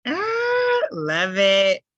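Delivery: high, loud burst of delight; excited whoop. Real, not acted. To the teacher about moving to Houston, an excited shout. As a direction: cheer the idea out loud.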